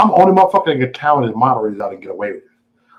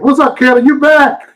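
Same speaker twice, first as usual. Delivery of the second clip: high, loud hail to a returning friend; loud surprised welcome back; excited greeting; 12 semitones higher, 7 dB louder than usual. Real, not acted. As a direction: holler a delighted hello, pitched way up. Calls out to returning viewer Kelly.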